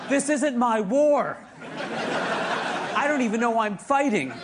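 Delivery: high, drawn-out mock protest; protesting chess king. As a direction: stretch it out, high, as a lightly played king in distress. Acts out the indignant chess king.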